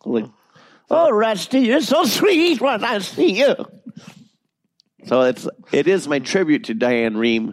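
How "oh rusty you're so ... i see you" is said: put-on high old granny voice, louder, pitch breaking upward; sweet granny whose voice spazzes up; Granny with voice cracks. Shown as a demonstration. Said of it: "I'll do that with Granny".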